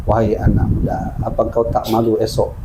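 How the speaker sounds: low, hushed reproach from the grave; solemn voice of a dead woman, fading at the end; dead woman speaking. Acted. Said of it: "perempuan itu berbicara".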